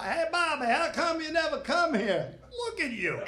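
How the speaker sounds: high, sing-song heckling; mock Alabama lady. Lady heckling from the back, high mock call. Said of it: "some lady in the back".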